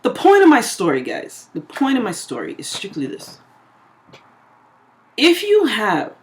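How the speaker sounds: usual speaking voice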